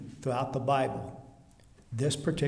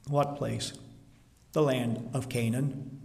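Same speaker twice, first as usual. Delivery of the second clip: normal talking voice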